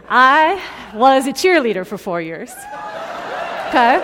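high, loud mock boast; loud proud announcement. Real, not acted. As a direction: proclaim it boldly to a laughing crowd. Mock boast to laughing audience.

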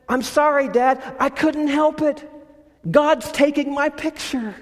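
put-on high, bright child voice, apologetic and delighted; little girl's voice. Girl answers her father's scolding in a high, happy child voice. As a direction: lift up into a small girl who is thrilled, not sorry.

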